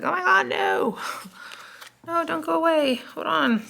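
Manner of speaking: high, pleading cry of someone left behind; desperate plea. Pretend. A lover begging as the reader walks away, high pleading voice. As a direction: beg them not to go, way up high.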